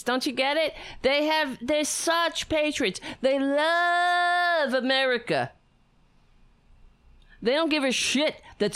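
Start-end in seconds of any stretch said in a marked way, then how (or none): 0.0-5.5 high-pitched mock gushing; sarcastic praise. Host mocking anthem-singing patriots, high sarcastic gush. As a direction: feign adoration, drawing out the key word.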